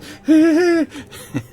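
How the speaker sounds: a sudden high cackling laugh; high burst of laughter. Real, not acted. Gamer amused by the coffee maker, high laugh.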